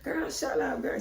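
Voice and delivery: high, breathy laughing brush-off; laughing dismissal. Retold or imitated. Friend giggling off the teasing, high laughing. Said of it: "so she just laughing like".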